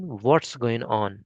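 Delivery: "What's" drawn out and falling; very slow question. Shown as a demonstration. Teacher slowly modelling the question. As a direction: stretch every word out slowly. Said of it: "ये question है third question".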